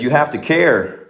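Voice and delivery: imitated pleading, pitched well up; retold urgent plea. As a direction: press the point as the pleading kid. Retold kid pleading with sinking horse.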